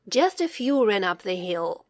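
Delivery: usual speaking voice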